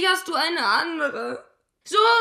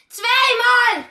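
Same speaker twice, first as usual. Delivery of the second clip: sharp, raised reproach; angry reproach; 6 semitones higher, 3 dB louder than usual. Acted. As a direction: fling it at him, hurt. Jilted girl reproaches lover. (gekränkt und vorwurfsvoll)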